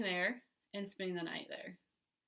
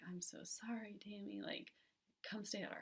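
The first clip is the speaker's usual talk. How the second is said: soft sympathetic whisper; 10 dB quieter than usual. Retold or imitated. Sister comforting her on the phone, whispered. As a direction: breathe the comfort out, voiceless. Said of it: "So she's just like".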